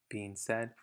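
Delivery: plain reading voice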